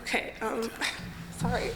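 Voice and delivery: choked up. Real, not acted. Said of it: "You need some water? You all right?".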